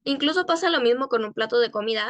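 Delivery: ordinary talk, nothing marked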